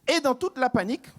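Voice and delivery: ordinary talk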